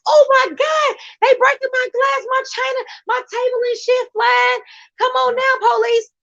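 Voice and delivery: put-on high, shouting old lady calling the cops; panicked granny. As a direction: scream down the phone like a frantic grandma.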